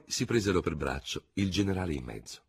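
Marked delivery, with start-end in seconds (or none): none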